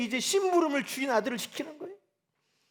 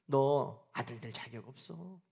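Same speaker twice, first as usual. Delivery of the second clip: Satan whispering; 8 semitones lower, 6 dB quieter, slower than usual. Pretend. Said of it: "사단은 끊임없이 우리에게 속삭이는".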